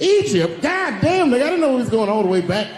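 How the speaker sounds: high, loud exasperated disbelief; incredulous outburst. Acted. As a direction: erupt as if blindsided in an argument. Mock outrage at rival's argument.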